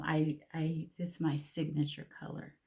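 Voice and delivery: ordinary delivery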